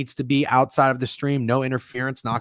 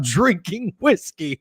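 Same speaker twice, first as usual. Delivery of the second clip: ordinary talk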